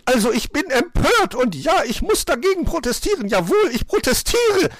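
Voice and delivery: very high, loud indignant magpie; outraged squawking tirade; shrieking protest. A narrator's reading. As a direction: scold at the top of your voice. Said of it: "begann sie gleich zu zetern".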